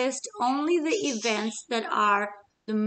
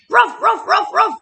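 much higher, much louder barking; fierce dog barks; 12 semitones higher, 12 dB louder than usual. Acted. Dog answering Middle Chick, loud high fierce barking. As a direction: bark back hard like an angry dog.

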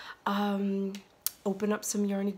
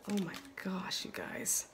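awed whisper; 6 dB quieter than usual. Real, not acted. Awed while opening yarn.

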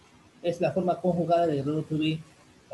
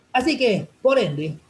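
loud burst; 6 semitones higher, 8 dB louder than usual. Real, not acted. Teacher bursts in loudly.

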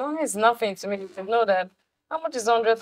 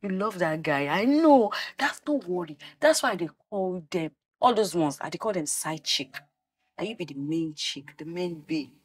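ordinary talk

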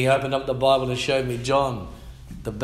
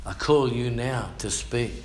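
ordinary talk

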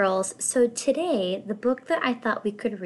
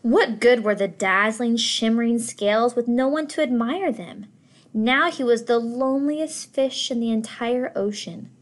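louder, emphatic storytelling; dramatic read; 2 semitones higher, 5 dB louder than usual. A narrator's reading. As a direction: lean into the loneliness. Narrator dramatises loneliness.

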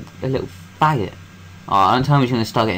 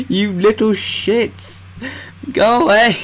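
laughing exasperation; 11 semitones higher, slower than usual. Real, not acted. Laughing at chasing monsters.

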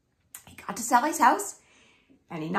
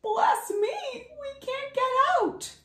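high, sniffling voice; sniffly Loris; 13 semitones higher than usual. Acted. Loris sniffling after falling into the hole. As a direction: sniffle through the words, pitched up. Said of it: "Sniffled Loris".

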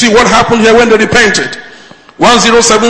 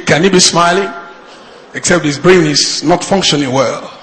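normal talking voice

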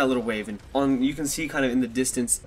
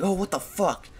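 mild mock panic, pitched up; retold freak-out; 6 semitones higher than usual. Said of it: "I freaked out".

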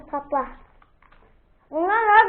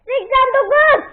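excited; 9 semitones higher, 5 dB louder than usual. Real, not acted. Announcing a high number.

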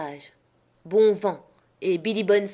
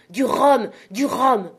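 loud, raised, changed voice crying out twice; desperate cry; 5 semitones higher, 7 dB louder, slower than usual. A narrator's reading. Captain desperately demanding rum from Jim, loud cries. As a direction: beg and order at once, over and over.

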